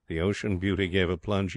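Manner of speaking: plain reading voice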